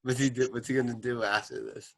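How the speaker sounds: ordinary talk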